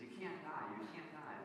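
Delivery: a hint of a movie heroine's plea; woman pleading. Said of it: "the woman of the movie".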